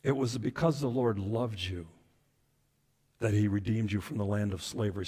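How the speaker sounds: soft, low, intimate verse; tender scripture reading. Acted. Preacher repeats God's words of love, hushed and tender. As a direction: let the verse sink in gently.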